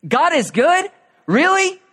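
high, loud incredulous cry; doubting scream. Pretend. Wilderness world screaming doubt at Jesus, high and loud. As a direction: shout it as a disbelieving question. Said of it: "everything's screaming".

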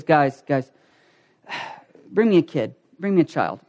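low, sighing appeal to squabblers; lightly acted tired, low-voiced request; weary patience. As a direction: imitate a patient teacher calming the group. Jesus settles the disciples' quarrel.

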